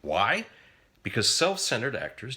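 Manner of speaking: ordinary talk, nothing marked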